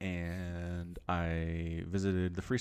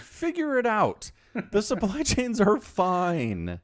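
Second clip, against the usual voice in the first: exasperated lecture; 11 semitones higher, 4 dB louder, slower than usual. Real, not acted. Ranting at hoarders.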